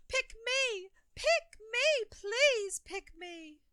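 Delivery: put-on high, loud begging child's voice, the last please slow; pleading child. A narrator's reading. Ollie begging the crowd to pick him, high and loud. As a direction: beg desperately to be chosen. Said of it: "he would plea from the midst of the crowd".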